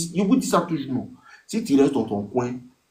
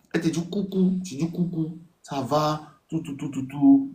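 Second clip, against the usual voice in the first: light girly voicing; 6 semitones higher than usual. Acts the exchange again.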